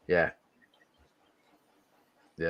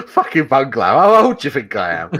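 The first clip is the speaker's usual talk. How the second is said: laughing; 9 semitones higher, 8 dB louder than usual. Real, not acted. Mock offence, laughing.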